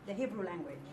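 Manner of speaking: ordinary talk, nothing marked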